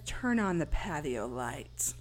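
low, slow killer command; menacing caller voice. Acted. Killer ordering Casey over the phone, low slow menace.

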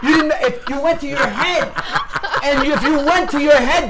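high, loud, carried-away defence; excited shouting. Real, not acted. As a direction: keep yelling over the laughter. Shouting on after the shouted exercise.

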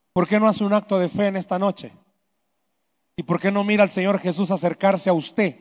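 normal talking voice

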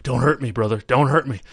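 playful Hogan impression pleading. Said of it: "he's telling Undertaker".

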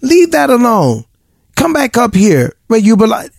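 put-on high, scolding parent calling a child back; mock-scold like a parent to a toddler. Parent scolding little children for poking around back there. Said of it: "how parents tell little children".

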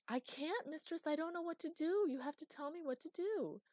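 high, panicky helpless pleading; frightened pleading. Acted. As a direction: beg for orders in a panic. Terrified servant pleads.

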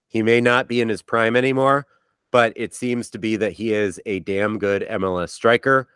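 usual speaking voice

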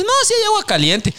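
very high mock wife voice; high teasing wife impression; wife's voice. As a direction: squeak it like the sly wife. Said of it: "y me dice".

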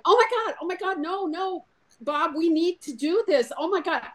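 imitating a client in a panic, high and fading; panicked wife. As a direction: play the frightened wife losing her nerve. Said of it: "how stressed she is".

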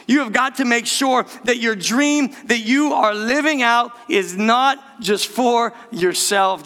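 usual speaking voice

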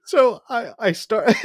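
high voice, breaking into laughs; talking through laughter. Real, not acted. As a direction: try to continue while cracking up. Tries to continue through laughter.

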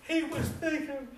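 high, loud, fervent preaching cadence; impassioned preaching. Acted. Preacher to congregation, high loud fervent preaching. Preacher rising to full force.

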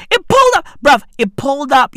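ordinary talk

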